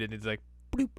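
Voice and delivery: ordinary talk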